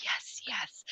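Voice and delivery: soft unvoiced "Yes, yes" like a ventriloquist's dummy; creepy doll whisper. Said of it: "ventriloquist creepy doll sound".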